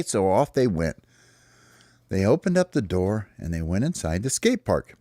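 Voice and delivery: ordinary delivery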